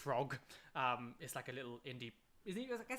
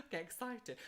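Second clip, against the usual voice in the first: ordinary talk, nothing marked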